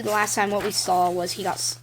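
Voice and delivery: normal talking voice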